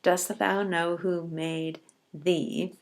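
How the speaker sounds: slow recitation. Shown as a demonstration. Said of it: "that has six syllables".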